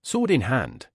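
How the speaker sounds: a lightly voiced battle cry. A narrator's reading. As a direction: call the men to draw, understated. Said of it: "voice crying out".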